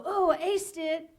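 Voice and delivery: slow, gloating imitation of triumph; mock self-congratulation. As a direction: crow like an overconfident actor. Imitates overconfident actor after audition.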